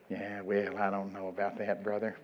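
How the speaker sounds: low, quiet reluctant reply; mock skeptical church member, soft and low; imitated doubter. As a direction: grumble doubtfully under the voice. Voices a doubtful listener.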